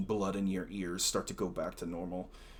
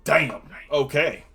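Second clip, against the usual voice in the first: excited; 6 semitones higher, 13 dB louder than usual. Real, not acted. GM reacts to a great roll.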